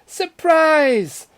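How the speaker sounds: high, loud, drawn-out cry; excited surprise. A narrator's reading. Mamma and Papa presenting a kitten, excited shout. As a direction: sing out the surprise at full volume. Parents reveal a kitten gift.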